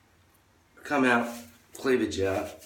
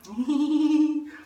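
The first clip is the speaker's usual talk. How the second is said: much higher mocking giggle; high girly giggle; 4 dB louder than usual. Girl leaving in the taxi gives a high mocking giggle.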